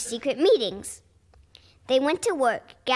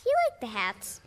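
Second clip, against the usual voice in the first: ordinary delivery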